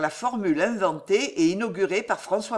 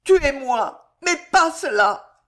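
high, much louder anguished scream; desperate cry; 7 semitones higher, 7 dB louder, slower than usual. Acted. Jeanne to the constable, choosing death over divorce: high loud scream. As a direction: beg him to kill her instead.

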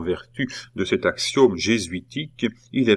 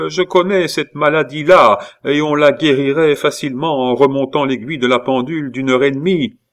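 higher, louder dismissive aunt; scornful old-maid aunt; 6 semitones higher, 6 dB louder than usual. A narrator's reading. Aunt dismissing her nephew's claimed illness, scornful. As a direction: brush off the excuse with brisk scorn.

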